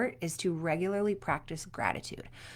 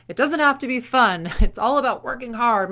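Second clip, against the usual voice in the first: loud, higher mock drill-sergeant parent; mock tough coach; 4 semitones higher, 10 dB louder than usual. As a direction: bark it like a no-fun sports parent. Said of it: "parents who are like".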